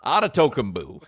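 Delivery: Antetokounmpo said "AH-duh-TOH-kum-boo"; imitated mispronunciation. Host echoing the announcer's botched name, mispronouncing. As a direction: mangle Antetokounmpo, ending on "boo", amused. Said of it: "this is what happened".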